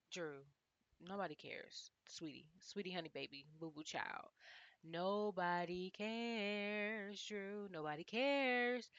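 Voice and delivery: half-asleep dismissive murmur; sleepy mock nap voice. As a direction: talk as if dozing off to tune her out. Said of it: "five-minute nap".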